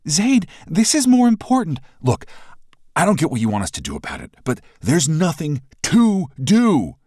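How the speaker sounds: heated yell at a stubborn friend; shouting. A narrator's reading. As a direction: shout it at her in frustration. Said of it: "I shout".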